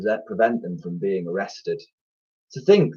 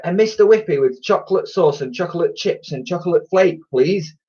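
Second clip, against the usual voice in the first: lightly voiced boy, higher pitch; a higher boyish voice, polite; eager boy; 7 semitones higher, 3 dB louder than usual. A narrator's reading. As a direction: order like a hopeful child. Boy ordering ice cream.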